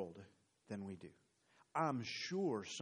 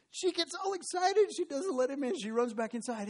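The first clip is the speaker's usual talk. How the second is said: high, louder, rushing storytelling; excited narration; 13 semitones higher, 5 dB louder than usual. Acted. Preacher telling how the girl ran inside, high and excited. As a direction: swept up in her excitement.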